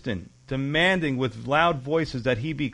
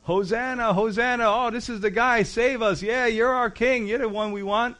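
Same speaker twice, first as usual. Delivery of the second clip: higher, eager cheer of the crowd; mock crowd praise; 6 semitones higher than usual. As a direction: hail the king with excitement. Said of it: "what were they shouting".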